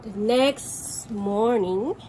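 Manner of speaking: plain reading voice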